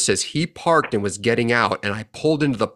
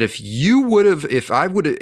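heated outburst; 6 semitones higher, 3 dB louder, slower than usual. Retold or imitated. Chewing out the reckless driver.

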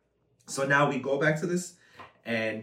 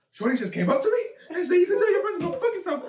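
high, laughing retold outrage; mock offended; 14 semitones higher than usual. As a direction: act out indignation while cracking up. Laughing while quoting the offended friend.

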